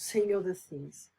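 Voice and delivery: ordinary talk, nothing marked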